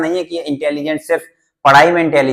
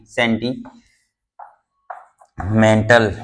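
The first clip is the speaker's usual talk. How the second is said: drawn out word; 4 semitones lower, much slower than usual. Shown as a demonstration.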